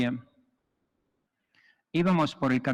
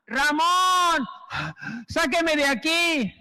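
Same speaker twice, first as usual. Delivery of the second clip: desperate plea; 11 semitones higher, much slower than usual. Retold or imitated. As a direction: shout your name and beg. El ahogado suplica. (grito suplicante y lento)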